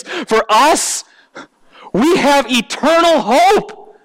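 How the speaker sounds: building to high, forceful proclamation; impassioned preaching. Real, not acted. Preacher proclaiming eternal hope to the congregation, impassioned preaching. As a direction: drive the key words home from the pulpit.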